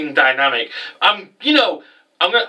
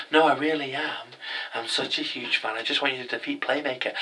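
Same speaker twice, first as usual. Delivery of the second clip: creepy stranger; 10 dB quieter than usual. Retold or imitated. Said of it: "He's like".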